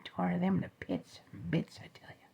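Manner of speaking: usual speaking voice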